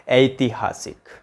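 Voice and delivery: ऐतिहासिक drawn out slowly, ai-ti-haa-sik; slow careful pronunciation. Shown as a demonstration. Teacher to students, slowly modelling the correct word. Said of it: "क्या होता है".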